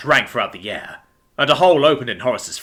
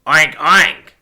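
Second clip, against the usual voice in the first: mocking pig noises, louder; sneering; 5 semitones higher, 7 dB louder than usual. A narrator's reading. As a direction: sneer the oinks. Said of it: "sneered Patrolman Pig".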